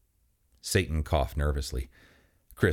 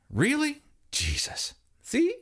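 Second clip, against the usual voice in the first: squeaky exasperated asides; high exasperated voice; slower than usual. Acted. Exasperated exchange.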